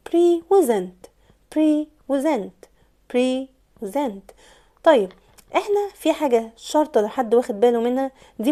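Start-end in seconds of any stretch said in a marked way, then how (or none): 0.1-4.3 "pre و zent" slowly broken apart, pree, then ZENT; split into syllables. Shown as a demonstration. Teacher dividing the word into two syllables, "pre و zent" spaced out. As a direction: separate "pre" and "zent" with clear gaps. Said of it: "كلمة present هنا هتنقسم لمقطعين".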